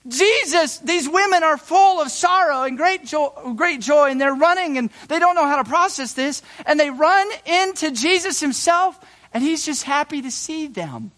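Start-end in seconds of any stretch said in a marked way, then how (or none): none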